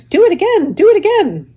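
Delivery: the goose urges Wilbur on, very high and loud; excited goose. Acted. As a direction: squawk it gleefully. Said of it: "said the goose".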